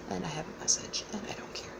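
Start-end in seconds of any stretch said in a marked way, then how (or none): 1.1-1.7 quick hushed aside under her breath; whispered aside. Real, not acted. Muttering an aside to someone in the room, whispered. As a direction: whisper it off to the side, not for the audience.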